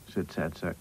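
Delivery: ordinary delivery, nothing marked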